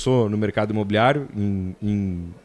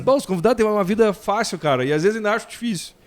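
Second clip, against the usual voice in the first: quoting himself; 8 semitones higher, faster than usual. Retold or imitated.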